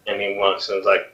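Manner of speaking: ordinary delivery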